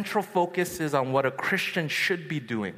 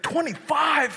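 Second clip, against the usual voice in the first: high, disbelieving question; incredulous; 10 semitones higher, 3 dB louder than usual. Retold or imitated. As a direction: react in shock to the age. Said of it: "I was like".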